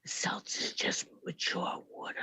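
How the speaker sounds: slow unvoiced whisper. Shown as a demonstration. Guest whispering a joke about seltzer. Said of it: "does it have to be in a whisper".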